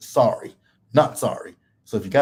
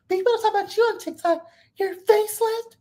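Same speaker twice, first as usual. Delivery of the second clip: much higher, fast mocking voice; high mock Whitney. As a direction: rattle it off high and snide. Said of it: "Whitney mocking Lisa".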